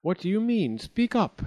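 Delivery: high, pressing queen; queen urging. A narrator's reading. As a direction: push her to speak. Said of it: "said the queen".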